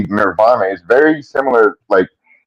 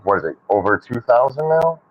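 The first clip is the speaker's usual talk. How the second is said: normal talking voice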